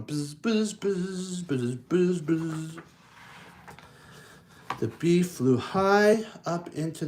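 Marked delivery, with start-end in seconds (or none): 0.0-2.8 buzzing bee. Acted. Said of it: "the bee's going".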